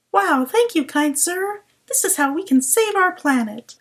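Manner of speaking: high, awed, naive alien voice; gullible alien voice. Pretend. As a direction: gush like a dupe. Imagined gullible alien thanks.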